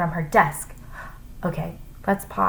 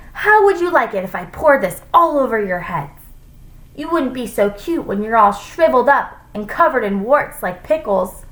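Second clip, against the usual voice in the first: higher, louder menace; threatening; 5 semitones higher, 4 dB louder than usual. A narrator's reading. Teacher holding brine over the class, threatening. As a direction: threaten with scornful relish.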